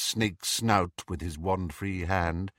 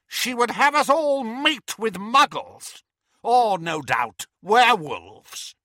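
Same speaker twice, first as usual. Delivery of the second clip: anger and contempt; 10 semitones higher than usual. Acted. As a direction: spit out each charge with disgust. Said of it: "the anger and contempt in Voldemort's voice".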